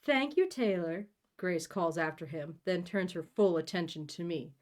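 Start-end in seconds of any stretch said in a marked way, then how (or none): 0.0-1.1 higher-pitched, theatrical lady of the house; mother's society voice. Acted. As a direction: thank the staff as a grand mother.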